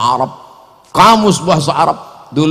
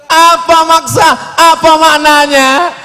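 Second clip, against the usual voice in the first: high, loud mock question; mock questioner; 10 semitones higher, 5 dB louder than usual. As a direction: ask it in a high, loud put-on voice. Mocking audience question. (suara penanya dibuat-buat, tinggi dan keras)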